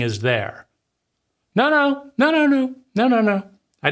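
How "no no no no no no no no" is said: high, quick string of no's, hammed up; a parent denying everything in a fluster; imitating a parent who protests too much; mock frantic denial. Adult denies, mock voice.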